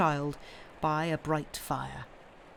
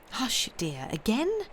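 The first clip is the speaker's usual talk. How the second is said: gentle reproach; 5 semitones higher than usual. Acted. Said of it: "Lucy remonstrated".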